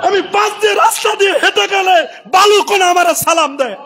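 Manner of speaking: ringing sermon delivery; impassioned preaching. Acted. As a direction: preach it out to the crowd. Preaches at full force.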